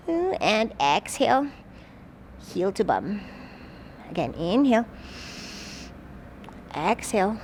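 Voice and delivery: usual speaking voice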